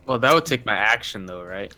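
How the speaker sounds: ordinary talk, nothing marked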